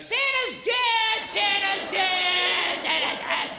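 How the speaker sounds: put-on high, drawn-out panicked chant of small children; kids shrieking. Small kids in the back seat wailing at a flattened Santa, high slow sing-song shriek. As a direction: wail it slow and high like a frightened little kid. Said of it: "they're like".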